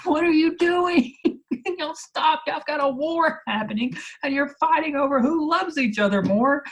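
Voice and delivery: very high, loud squealed scolding with a laugh; hammed-up exasperation. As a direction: squeal in comic disbelief at their squabble. Her exasperated reaction to their quarrel.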